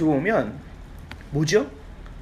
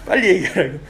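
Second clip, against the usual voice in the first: imitated impatient nudge, louder; mildly annoyed hurrying; 5 dB louder than usual. Staff rushing the tearful new father, mildly annoyed. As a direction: hurry them up with slight irritation. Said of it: "약간 짜증을 내시더라고요".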